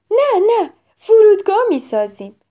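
high, loud eager voice; excited child. Acted. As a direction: bubble over with ideas. Excited child planning play.

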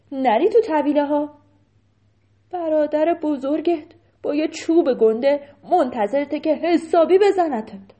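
loud urgent warning from a cow; a cow urgently warning Bata. Acted. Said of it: "یکی از گاوا برگشت بهش گفت". (گاو با هشدار بلند)

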